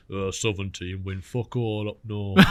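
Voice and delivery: slow, drawn-out caller with a put-on voice; slow mock proclamation in another man's voice; Alan Scott. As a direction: impersonate a man, dragging every word out slowly. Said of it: "the best Alan Scott impression ever".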